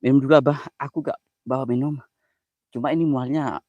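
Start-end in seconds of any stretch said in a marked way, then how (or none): none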